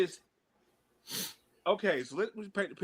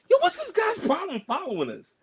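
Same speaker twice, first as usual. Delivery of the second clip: high, sharp, challenging call, much higher; irritable call-out; 12 semitones higher, 3 dB louder than usual. Retold or imitated. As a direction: confront the stranger. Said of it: "real irritable to sometimes".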